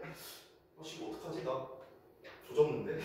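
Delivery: unvoiced whispered panic to himself; panicked mutter. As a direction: mutter in dread as your turn nears. Panicking inner thought. (속으로 겁먹고 속삭이듯)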